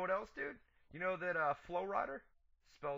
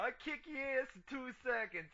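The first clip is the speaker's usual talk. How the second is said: higher hammed-up New York tough talk; mock New York accent; 12 semitones higher than usual. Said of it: "he puts the accent on".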